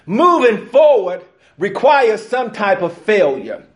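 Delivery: loud, high, fervent preaching to the church; full-force preaching. Acted. Pastor rallying his congregation about failure, loud fervent preaching.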